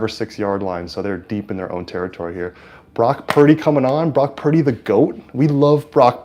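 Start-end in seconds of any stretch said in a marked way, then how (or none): 2.9-6.2 higher, louder announcer hype for the quarterback; play-by-play hype. Acted. Fan streamer hyping Brock Purdy's entrance, louder announcer call. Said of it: "impression of a, um, play-by-play announcer".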